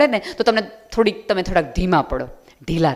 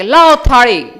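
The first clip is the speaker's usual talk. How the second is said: loud, booming mock royal command; TV serial magic command; 3 semitones higher, 10 dB louder, slower than usual. Mocking TV serial miracles to the audience, booming command. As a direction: call for the platter like a TV miracle king. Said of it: "ઓલા T V સિરિયલના".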